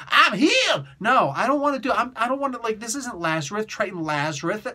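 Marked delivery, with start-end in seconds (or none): 0.1-0.9 high, chirpy quote of a revived hero; mock healed fish-man. Reviewer mocking Triton's comeback, high and chirpy. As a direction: toss it off as a silly miracle boast. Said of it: "comes out talking about".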